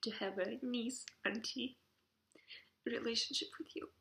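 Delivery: tearful, voice breaking; choked up and halting; crying. Real, not acted. Crying while addressing niece.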